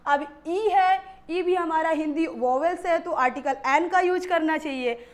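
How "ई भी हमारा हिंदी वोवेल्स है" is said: ordinary talk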